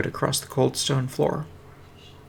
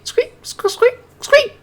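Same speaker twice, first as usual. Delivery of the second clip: very high squeaks, getting much louder; urgent rat squeaks; 8 dB louder, much slower than usual. Acted. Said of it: "Morty said urgently".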